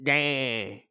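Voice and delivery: lightly mimicked old-time man; a hint of mock male voice. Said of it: "all the guys are like".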